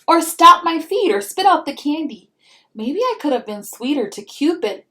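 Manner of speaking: lively storybook read-aloud; reading to children. A narrator's reading. Mom reading a picture book to her child, storybook voice.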